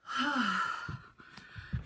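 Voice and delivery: strained grunt while stretching; effort grunt. Real, not acted. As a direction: grunt with effort through the pose. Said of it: "Sorry, we're grunting the whole time".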